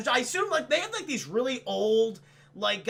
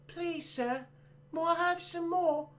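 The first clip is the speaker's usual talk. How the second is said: a light Cockney orphan begging, high and soft; Cockney accent; 8 semitones higher, 4 dB quieter, slower than usual. Retold or imitated. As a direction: plead in a mild Cockney accent, like a timid orphan. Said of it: "Cockney accent".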